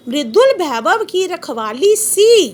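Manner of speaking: slow, measured reading of verse; poem recitation. Shown as a demonstration. Teacher reciting the poem to the class, slow and measured. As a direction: savour each word like a recital. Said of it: "कवि कहता है".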